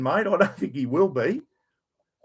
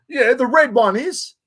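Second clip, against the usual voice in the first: mocking the bloke; 8 semitones higher, 6 dB louder than usual. Mimics the bloke's reply.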